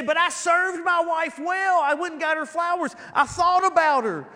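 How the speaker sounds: a hint of a self-justifying spouse; defensive husband. Husband pleading his case to the pastor, mildly indignant. Congregant voiced defending himself.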